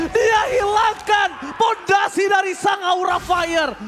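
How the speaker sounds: excited shout. Real, not acted. Caster hypes a big play.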